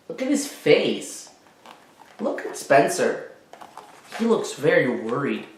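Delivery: usual speaking voice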